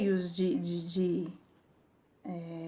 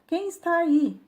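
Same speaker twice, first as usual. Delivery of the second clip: high, lightly raised echo of a call; 8 semitones higher, 5 dB louder than usual. A narrator's reading. Mountain answers the curious boy, mild echoed shout. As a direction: repeat the call, much higher. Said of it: "e recebe uma resposta".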